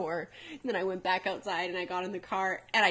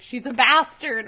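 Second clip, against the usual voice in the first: put-on loud, slow child insisting; a kid stubbornly repeating herself to her mom; defiant kid; 4 semitones higher, 11 dB louder, slower than usual. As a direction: plant your feet and say it loud.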